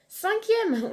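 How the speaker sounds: a high, loud jump on cinquième; sudden correction. Real, not acted. Realising she miscounted the listings, a high startled correction. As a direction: catch your own mistake out loud.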